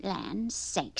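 a hint of a flustered grandma; mutedly exasperated grandma; grandma's exclamation. A narrator's reading. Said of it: "said Grandma".

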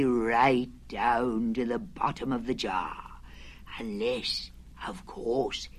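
low, slow, muttering Pooh. Acted. Pooh at home reasoning about the honey jar, low and slow.

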